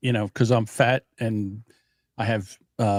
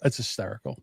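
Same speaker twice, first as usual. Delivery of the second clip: ordinary talk, nothing marked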